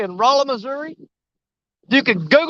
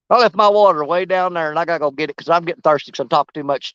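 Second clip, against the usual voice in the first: ordinary talk, nothing marked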